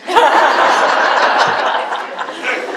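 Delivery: laughs out loud at his own punchline; big real laugh, high and loud; loud burst of laughter. Real, not acted. Telling a funny story to the radio host, laughing out loud.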